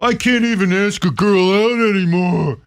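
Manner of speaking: high whining complaint; mock a whiny guy who can't ask girls out; high-pitched mocking whine. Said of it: "I hear a lot of the".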